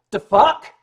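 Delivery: loud, high gruff New York "The fuck?"; gruff New York outburst. Retold or imitated. Security chief barking at the Furries in the lobby, loud and gruff. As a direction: bark it like an outraged security chief.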